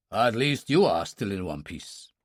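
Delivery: lightly voiced old Chinese master, low and measured; a hint of the unruffled kung fu uncle; calm old master. A narrator's reading. Yuan Tao reassuring Dylan after the fight, lightly voiced calm master.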